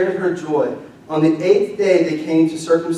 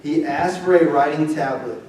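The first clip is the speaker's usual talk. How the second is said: ordinary talk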